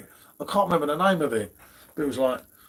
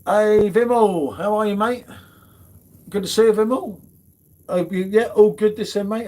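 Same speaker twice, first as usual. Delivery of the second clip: hearty greeting; 6 semitones higher, 4 dB louder than usual. Real, not acted. Host greeting a viewer who joined, hearty raised-voice hail. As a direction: call out to a mate who just arrived. Hailing a viewer joining the stream.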